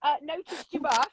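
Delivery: high, chirpy mock-friendly neighbour; nosy campers. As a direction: play the curious camper greeting the newcomer. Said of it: "along come the people go".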